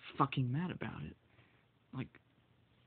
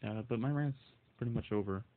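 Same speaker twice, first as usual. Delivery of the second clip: normal talking voice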